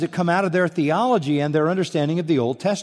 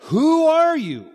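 demanding question; 4 dB louder, slower than usual. Retold or imitated. Delegation demands John's identity.

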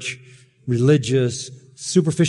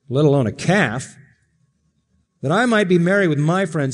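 resentful; 7 semitones higher than usual. Retold or imitated. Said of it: "It's a duty. It's a grind".

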